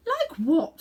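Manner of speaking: loud, lofty queen's voice; haughty queen. A narrator's reading. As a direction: talk down to a common boy. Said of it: "said Jadis".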